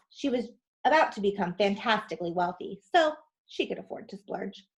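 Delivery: usual speaking voice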